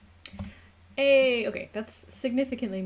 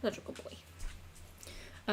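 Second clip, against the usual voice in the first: tender whisper to pet; 7 dB quieter, much slower than usual. Real, not acted. Owner praising her pet softly, slow tender hush. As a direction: soothe him softly, almost breathless.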